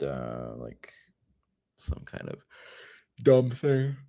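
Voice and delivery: talking through a yawn; words stretched by a yawn. Real, not acted. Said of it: "I'm actually yawning on the live stream".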